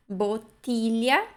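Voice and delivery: "Botilha" slow, hard double t and lh; correct hard ti. Shown as a demonstration. Teacher repeats the correct word, slow Italian pronunciation. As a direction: draw out "Botilha" without any ch. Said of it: "dois T's, G L I".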